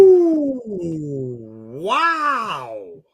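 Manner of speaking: long high whoop into wow; drawn-out, hammy astonishment; amazed. Real, not acted. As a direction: howl it in amazement. Reacting to the surprising pick.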